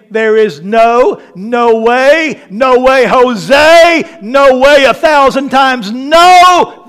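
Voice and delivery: preacher hammering every "no" harder; emphatic NO. Shown as a demonstration. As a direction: escalate each "no way" to a long, loud final "no". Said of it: "is in the emphatic".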